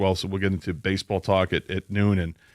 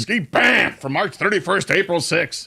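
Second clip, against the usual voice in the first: booming monster truck promo read; 7 semitones higher than usual. Retold or imitated. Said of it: "I did it in a monster truck voice".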